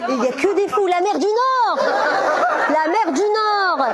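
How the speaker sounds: high, loud, hammed-up Flemish accent; mock Flemish accent. Comedian mimicking audience member on the North Sea, loud mock Flemish accent. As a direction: parrot it as a big Flemish cry. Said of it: "Ah, c'est l'accent flamand".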